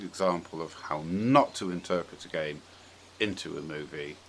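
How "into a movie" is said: ordinary delivery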